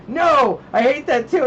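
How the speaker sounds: high, loud mock kid outburst; child's tantrum imitation. Dad imitating his son losing the tablet, loud high protest. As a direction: imitate a defiant kid at full pitch.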